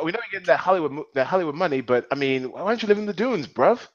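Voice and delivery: put-on cockney bruv accent, fast and chirpy; a terrible attempt at a London accent; British accent. Teasing a cohost in a bad British accent. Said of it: "that was a terrible attempt".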